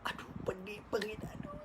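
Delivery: whispered, hurting, barely voiced; pained whisper. Yusuf hurting inside at his brothers' tale, whispering. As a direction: breathe the pain out in a whisper. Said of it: "sedih lah ingat cerita lama ni".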